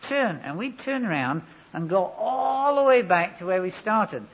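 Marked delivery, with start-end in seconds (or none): 0.0-0.4 high, loud barked command; drill command. Retold or imitated. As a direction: snap the order across the square. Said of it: "And then he would shout".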